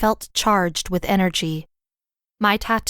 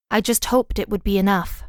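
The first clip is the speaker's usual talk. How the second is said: ordinary delivery, nothing marked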